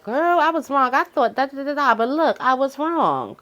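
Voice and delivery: high, bright-eyed confession to a friend; mock girlfriend admission. Talking to a friend, owning a mistake in a high mock voice. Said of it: "I'll be like".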